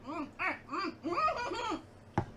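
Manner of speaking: high disgusted mm sounds; grimacing hums. Real, not acted. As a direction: hum through a bad taste. Reacting to mints and bourbon.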